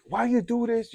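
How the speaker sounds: high-pitched scolding without real volume; mock yell. Dad turning round to scold son, mild mock yell. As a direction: imitate a parent telling off a child. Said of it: "he yells at me".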